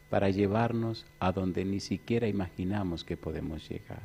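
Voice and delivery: soft, slow, low reverent build; hushed preaching. Acted. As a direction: draw them in quietly.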